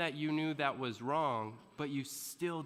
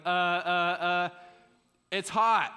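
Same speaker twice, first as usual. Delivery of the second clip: louder, stammering, caught-out; flustered excuse; 4 semitones higher, 10 dB louder, slower than usual. Retold or imitated. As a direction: stumble over a lame excuse. Caught teen making excuse.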